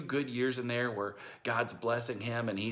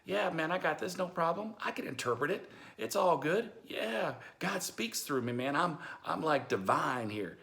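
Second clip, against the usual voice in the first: mock swaggering boast, pitched higher; cocky bragging; 7 semitones higher than usual. Pastor imagining a cocky Joseph, mock boastful. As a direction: brag like a show-off who's got this. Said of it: "He could have said".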